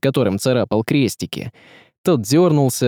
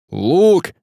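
sudden higher-pitched cry; urgent exclamation; 6 semitones higher, 4 dB louder than usual. A narrator's reading. Горыня cries for a bow mid-fight, urgent exclamation. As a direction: call out urgently for a weapon. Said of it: "воскликнул Горыня".

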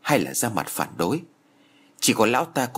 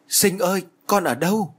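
wailing cry; 9 semitones higher than usual. A narrator's reading. Mother waking among neighbours, high desperate wail. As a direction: cry out the moment she wakes. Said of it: "đầu tiên bà gào lên".

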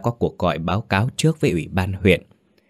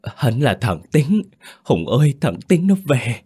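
higher-pitched, slowed cry of recognition; old mother's raised, emotional call; 6 semitones higher, 3 dB louder than usual. A narrator's reading. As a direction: call out to a long-lost son. Great-grandmother recognising her lost son.

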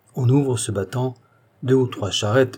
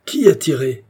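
loud, higher demand; shouted question; 4 semitones higher, 6 dB louder than usual. A narrator's reading. A voice demands who fired, shouting. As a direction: cry out to find the shooter. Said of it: "cria une voix".

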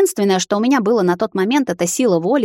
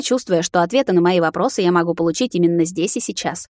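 plain reading voice

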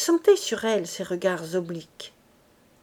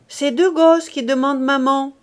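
higher, much louder call across the washhouse; shouted; 4 semitones higher, 8 dB louder than usual. Acted. Charles shouts to the washerwomen, loud call. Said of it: "cria Charles".

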